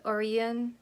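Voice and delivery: "Orion" said OR-ee-un; queried pronunciation of Orion. Shown as a demonstration. Viewer recalling the original series, "Orion" as OR-ee-un. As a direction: say "Orion" slowly as OR-ee-un. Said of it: "Was that pronounced Orion?".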